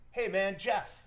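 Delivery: high, loud, upbeat greeting; mock-cheery high-pitched hello; Jeff Bezos. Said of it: "and he goes".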